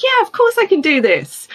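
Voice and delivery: high-pitched, confident yes; acting out an eager yes; breezy agreement. Eagerly accepts mentor's offer in retelling.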